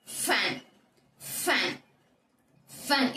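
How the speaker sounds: "ஃபேன்" with a long airy f before the vowel; drawn-out F sound. Shown as a demonstration. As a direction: hold the hissing f, then release "ஃபேன்". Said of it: "என்னோட மவுத்தை மறக்காம watch பண்ணுங்க". ("ஃபேன்" - நீண்ட ஃப் ஒலியுடன்)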